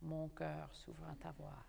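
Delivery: hushed demo of a seductive aria line; back-wall sensation. Shown as a demonstration. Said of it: "have that sensation on the back wall".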